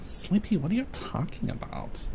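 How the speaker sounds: high teasing question; mock indignation. Real, not acted. Asking chatter Sleepy, high mock indignant.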